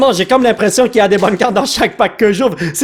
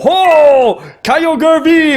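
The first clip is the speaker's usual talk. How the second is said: excited; 8 semitones higher, 3 dB louder, much slower than usual. Real, not acted. Excited about a repeat pull.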